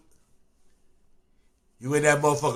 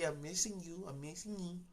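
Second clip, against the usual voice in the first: much softer, sweet plea; soft missing-you; 22 dB quieter than usual. Retold or imitated. Girlfriend telling the inmate she misses him, softly. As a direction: breathe it softly like a lover. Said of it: "She come back".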